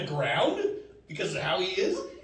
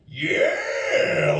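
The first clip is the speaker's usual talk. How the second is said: cocky yeah; 4 dB louder than usual. Retold or imitated. Macho posing on motorcycle.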